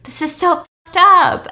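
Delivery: high, loud mock amazement; retold amused exclamation. Onlooker filming his friend on video, rowdy exclaiming. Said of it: "And then he laughs".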